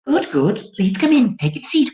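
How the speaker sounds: high, eager little gnome voice; gnome greeter. Acted. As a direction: welcome the guest brightly, pitched well up.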